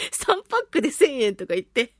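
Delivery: ordinary talk, nothing marked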